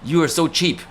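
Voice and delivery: high mock scolding father; yelling dad. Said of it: "my dad was yelling at me".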